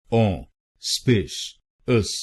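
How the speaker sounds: Auspicious stretched slowly, syllable by syllable as 'aw-spi-shus'; slow, broken-down 'aw-spi-shus'. Shown as a demonstration. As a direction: spell auspicious out slowly for learners. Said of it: "तो बनेगा auspicious".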